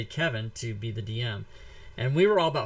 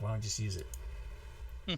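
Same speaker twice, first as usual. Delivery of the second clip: mock sulky mutter; 4 semitones lower, 11 dB quieter, slower than usual. Real, not acted. Teasing co-host about link.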